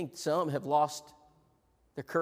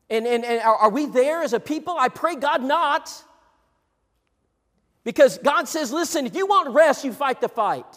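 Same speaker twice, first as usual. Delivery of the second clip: high, loud pleading climax; voicing God's command with fire; preaching at full force; 7 semitones higher, 8 dB louder than usual. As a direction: drive the point home to the church.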